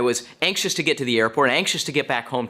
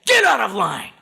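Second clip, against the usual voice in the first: loud, high shout acting out an angry man; angry yell; 7 semitones higher, 5 dB louder than usual. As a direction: yell the order at someone's back. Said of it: "He yells at the back of her head".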